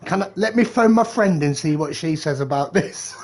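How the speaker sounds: laughing imitation of a flustered girlfriend; laughing mock. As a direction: mimic her while laughing. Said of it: "They'd be like".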